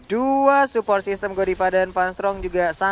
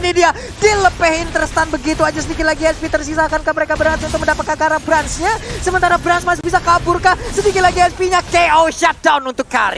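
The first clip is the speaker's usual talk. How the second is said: loud, higher, racing play-by-play; excited caster; 7 semitones higher, 5 dB louder than usual. Real, not acted. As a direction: call the kill like it's huge. Caster hypes a near kill.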